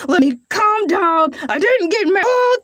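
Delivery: ordinary delivery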